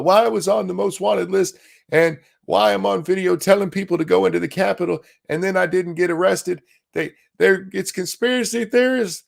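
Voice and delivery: high, whining victim voice; whiny mocking voice. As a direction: whine it out like a martyr. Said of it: "so he could go whine".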